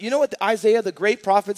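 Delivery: ordinary talk, nothing marked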